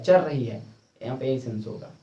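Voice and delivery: ordinary delivery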